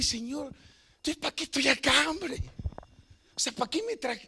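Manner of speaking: high, pleading cry; tearful plea to God. Retold or imitated. A pastor cries out to God, tearful. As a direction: beg God through tears. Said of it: "me fui a llorar".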